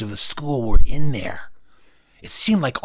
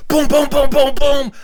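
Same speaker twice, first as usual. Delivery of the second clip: high, loud frantic booms; imitated desperate banging on a door; louder knocking; 7 dB louder than usual. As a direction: hammer out rapid knocks, pitched way up. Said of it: "You didn't knock loud enough".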